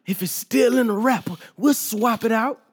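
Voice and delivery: playful imitation of a hype rapper. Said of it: "Like [name] say".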